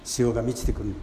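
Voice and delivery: usual speaking voice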